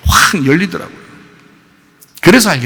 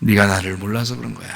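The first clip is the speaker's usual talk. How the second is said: tender Lord; 7 semitones lower, 8 dB quieter than usual. Retold or imitated. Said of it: "주님이 나한테 그래요".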